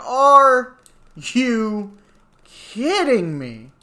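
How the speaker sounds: loud, high "Are" then slowing down; retold incredulous outburst; mock disbelief. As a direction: stretch out the disbelief. Disbelief at delay.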